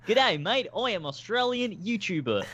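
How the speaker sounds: high hammed-up Aussie voice, laughing; overdone outback YouTuber drawl; Australian accent. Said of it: "That's me pretending to be Australian".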